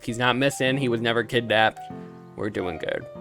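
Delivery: ordinary delivery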